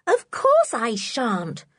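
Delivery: slow, offended little protest; indignant. Acted. Anne answers Dick, indignant and slow. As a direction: Anne bristling at being doubted. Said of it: "said Anne indignantly".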